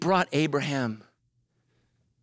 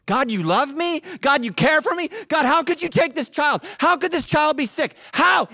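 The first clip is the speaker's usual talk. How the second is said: high, loud pleading at God; grieving parent's loud plea; anguished cry to God; 12 semitones higher, 5 dB louder than usual. Retold or imitated.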